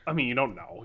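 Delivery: normal talking voice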